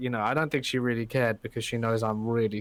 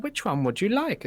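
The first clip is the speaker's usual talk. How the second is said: high, lilting customer-service question; sing-song higher pitch, pretend courtesy; mock-obliging offer; 9 semitones higher than usual. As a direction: imitate someone politely offering a choice. Imagined polite offer of a choice.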